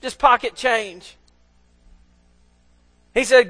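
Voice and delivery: usual speaking voice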